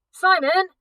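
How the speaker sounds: very high, loud call of his name; desperate cry. Acted. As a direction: scream his name in alarm. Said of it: "Kat cried".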